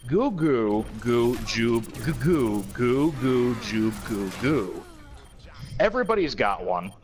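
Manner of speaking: slow, solemn recital of the lyrics; dramatic lyric reading. Acted. Reading Walrus lyrics aloud to co-hosts, slow and mock-solemn. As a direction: read it like grave poetry, taking your time.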